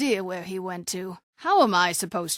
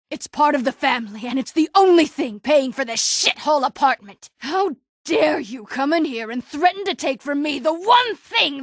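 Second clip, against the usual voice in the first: rising to a raging shout; loud, indignant fury; furious outburst; 5 semitones higher, 5 dB louder than usual. Acted. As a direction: tear into him for threatening her home.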